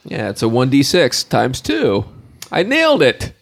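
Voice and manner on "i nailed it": high-pitched triumphant exclamation; excited. Real, not acted. Player celebrating a critical hit, excited. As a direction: crow it in a high voice.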